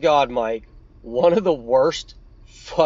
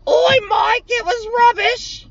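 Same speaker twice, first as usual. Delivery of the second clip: very high, shrill squeaky shout; mock Charlie shout; 4 dB louder, slower than usual. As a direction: squeal it like a chirpy girlfriend from across the room. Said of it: "Charlie chirping in the background".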